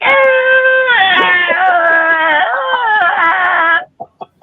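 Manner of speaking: a long, very high, loud mocking screech played as the sound of their shows; shrill mock cackle. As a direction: screech like nails on a chalkboard, mocking them. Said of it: "that's how that shit sounds".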